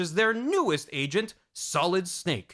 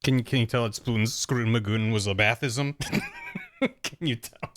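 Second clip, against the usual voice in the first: words through real laughter; laughing; 5 semitones lower than usual. Real, not acted. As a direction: crack up at your own silly line. Cracks up at own ad-lib.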